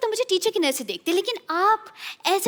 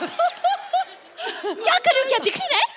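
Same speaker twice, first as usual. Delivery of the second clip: high drawn-out sass; 7 semitones higher, slower than usual. Acted. Defiant mock reply.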